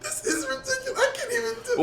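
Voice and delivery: very high squeal through laughter; helpless tearful laughing. Real, not acted. Calling the argument ridiculous, helpless squeaky laughter. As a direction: squeak it out, too tickled to speak.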